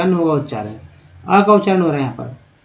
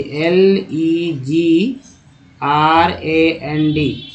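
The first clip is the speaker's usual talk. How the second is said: slow letter-by-letter spelling; much slower than usual. Shown as a demonstration. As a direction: lay out each letter slowly. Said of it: "उसके बाद दोस्तों देखिए". (धीरे-धीरे अक्षर गिनाते हुए)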